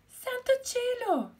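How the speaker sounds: very high, loud gasp of surprise; high loud astonished hen; shocked exclamation. Acted. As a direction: throw up her wings in shock. Tortoise emerges, hen exclaims.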